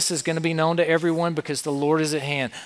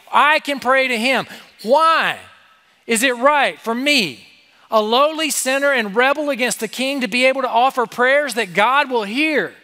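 high, louder, fervent preaching; full-force preaching; 7 semitones higher, 4 dB louder than usual. Real, not acted. Preacher to students, marveling loudly that God hears. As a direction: lift it in awe of God.

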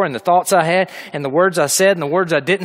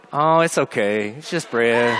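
tender reassurance; 7 semitones lower, slower than usual. Retold or imitated. Softening toward the pleading dog.

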